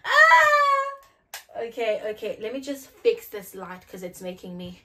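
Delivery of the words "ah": sudden high loud scream; startled shriek. Real, not acted. Sudden high loud scream as the light acts up. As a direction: yelp in shock.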